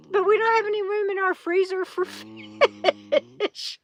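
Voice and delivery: high voice breaking into laughter; laughing. Real, not acted. Camper admits the freezer has no room for fish, laughing. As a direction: crack up as you reach the last word.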